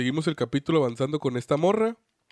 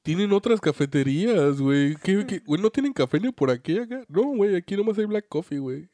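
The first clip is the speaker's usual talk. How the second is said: surprised; 6 semitones higher, 4 dB louder than usual. Retold or imitated. Acting surprised newcomer.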